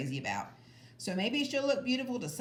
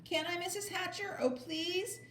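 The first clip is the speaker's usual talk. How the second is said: put-on much higher, pleading girl voice; begging; 11 semitones higher, 3 dB quieter than usual. A narrator's reading. Sheila begging Mrs. Hatcher to babysit, high pleading. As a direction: beg sweetly. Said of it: "begged some more".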